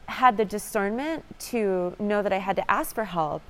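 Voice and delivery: ordinary talk, nothing marked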